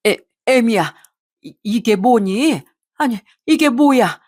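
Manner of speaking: slow, stammering shock; aghast. A narrator's reading. Mother-in-law shown the documents, stammering in shock. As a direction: stumble over the first words, then rise in alarm. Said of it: "얼굴이 새하얗게 질리더니 경악을 하며 얘기를".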